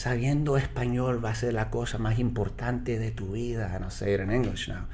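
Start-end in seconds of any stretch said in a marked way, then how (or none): 0.0-3.7 a lightly voiced grandma's advice in Spanish; soft Spanish, like grandma told him; la abuela, en español suave. Shown as a demonstration. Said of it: "I'll say it in Spanish".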